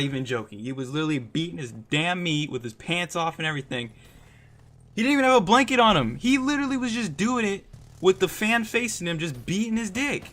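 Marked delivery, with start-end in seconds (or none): 5.0-10.2 high, fast and loud, can't believe it; outraged disbelief. Retold or imitated. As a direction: retell it still shocked, voice shooting up. Outraged retelling of catching friend.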